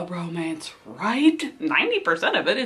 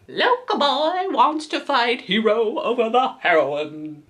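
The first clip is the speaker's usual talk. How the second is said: ordinary talk, nothing marked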